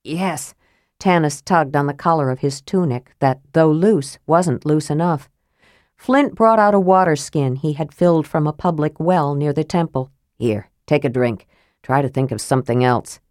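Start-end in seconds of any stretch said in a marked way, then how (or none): 0.0-0.5 softly spoken, short answer; subdued reply. A narrator's reading. Answer to a soft question. 10.4-13.2 low-pitched dwarf voice; steady a friend in a low voice; dwarf's reassurance. A narrator's reading. Dwarf soothes anxious friend.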